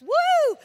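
loud high celebratory woo; joyful whoop. Real, not acted. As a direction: whoop it out to the crowd. Celebrating on stage.

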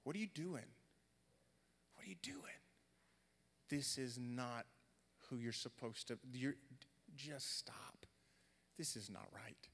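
hushed, half-whispered, spaced-out words; God's inner voice. Retold or imitated. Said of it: "it was like God was saying to me".

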